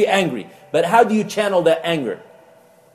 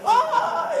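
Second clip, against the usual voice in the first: excited dog yelp; 4 dB quieter than usual. Pretend.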